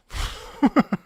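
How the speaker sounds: a sudden high laugh of delight; amazed laugh. Real, not acted. Laughing in awe at the game's music track. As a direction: laugh in disbelief at how good it is.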